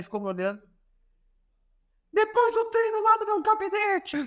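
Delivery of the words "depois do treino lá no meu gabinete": high-pitched mock order from a cross coach; Jorge Jesus summoning. Coach summons player to his office, high mock bark. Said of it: "Aí ele assim".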